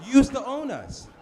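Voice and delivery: loud retort. Retold or imitated. Retort to the homeless man.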